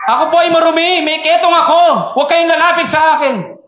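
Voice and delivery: high, loud cry of a leper warning people away; a shamed sick man shouting his uncleanness to passersby. Acted. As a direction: call it out high and loud so no one comes near. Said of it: "they shouted aloud".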